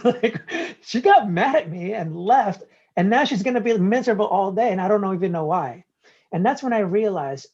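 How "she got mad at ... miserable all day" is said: normal talking voice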